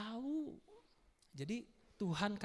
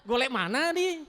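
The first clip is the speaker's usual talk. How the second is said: put-on Balinese accent; 7 semitones higher, 6 dB louder, slower than usual. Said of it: "Kalau orang Bali".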